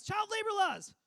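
high, very loud panicked yell; dad yelling. Retold or imitated. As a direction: yell it like a frantic immigrant dad. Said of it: "He just yells".

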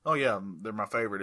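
dry, low-key sarcasm; sarcastic. Real, not acted. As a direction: feign fondness in an unimpressed, understated way. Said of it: "as you can tell from the sarcasm".

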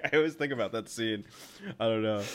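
ordinary talk, nothing marked

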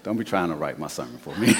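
quick words through laughter; tossed off with a chuckle; laughing. Retold or imitated. Pastor retells his reply to a joking congregant, laughing.

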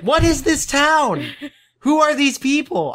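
high, slow, laughing disbelief; baffled laughing. Real, not acted. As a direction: exclaim in baffled amusement.

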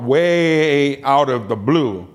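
long, slow stretch on "way"; drawn-out WAY. Real, not acted. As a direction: drag "way" out for emphasis. Stretches way to stress surprise.

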